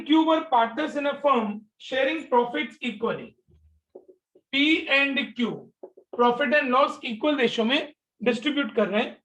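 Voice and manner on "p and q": ordinary talk, nothing marked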